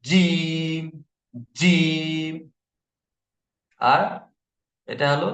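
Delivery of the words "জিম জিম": জিম said hard and loud; hard jim. Shown as a demonstration. Teacher showing students the hard jim sound. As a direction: land জিম with a firm hard j. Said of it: "শক্ত আওয়াজে হবে".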